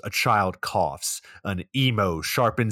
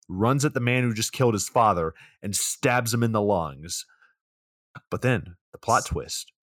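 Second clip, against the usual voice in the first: normal talking voice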